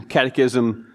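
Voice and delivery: ordinary talk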